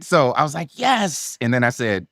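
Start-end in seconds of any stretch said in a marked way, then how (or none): 0.8-1.4 high, triumphant yes; retold elation. Actor hearing from his agent that he booked it, elated. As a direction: celebrate with a much higher pitch. Retold joy at booking the part.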